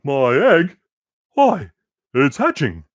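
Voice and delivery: high, loud amazed cry; excited shout. Acted. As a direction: shout with growing wonder. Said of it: "shouted Horton".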